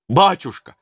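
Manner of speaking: put-on loud pleading cry of an old servant; old servant falling on his knees, crying out; sudden cry. A narrator's reading. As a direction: cry out to the tsar in desperation. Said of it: "воскликнул он вдруг".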